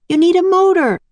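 high, loud urgent call. A narrator's reading. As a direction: shout the warning across. Annie calling up the hill.

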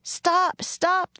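very high-pitched yell at modest volume. A narrator's reading. Two children chase the wagon, high urgent yell. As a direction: call out high and urgent after someone leaving. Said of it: "they both yelled".

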